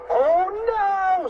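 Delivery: high, loud, drawn-out scream; screamed alarm. A narrator's reading. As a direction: scream it high in cartoon terror. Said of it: "screamed Fluffy".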